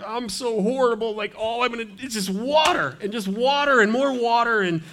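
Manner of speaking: high-pitched mock misery; hammed-up moan of self-pity; self-pitying wallower. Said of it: "Don't go home and be like".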